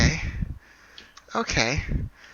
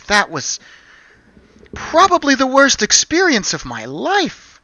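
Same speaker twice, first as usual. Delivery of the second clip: 1 relieved shaken woman; 6 semitones higher than usual. Acted. NPC voiced after being poisoned.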